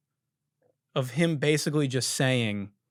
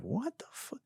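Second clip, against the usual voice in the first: hushed, under the breath disbelief; a much softer, stunned aside; muttered shock; 8 semitones higher, 15 dB quieter than usual. Retold or imitated. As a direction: say it to yourself so he can't hear. Seeing bloody leg.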